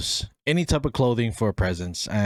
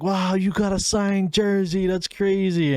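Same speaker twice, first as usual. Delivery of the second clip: higher, impressed exclaim; amazed crowd; 9 semitones higher than usual. Retold or imitated. Family reacting to the signed jersey, high and impressed. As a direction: react like a wowed room.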